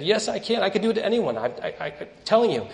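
lightly acted back-and-forth protest; retold insistence. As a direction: insist as the witch in a retold squabble. Witch insists in retold argument.

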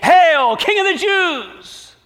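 high, loud, slow mock salute; exaggerated high sneering hail. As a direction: soldiers jeering a false king. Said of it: "mocked him".

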